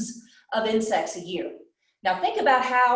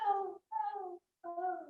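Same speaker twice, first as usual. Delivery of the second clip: high, much softer echo dying away; fading echo; 10 semitones higher, 12 dB quieter, slower than usual. Retold or imitated. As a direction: let it die off like an echo from the mountain. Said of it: "And he hears back".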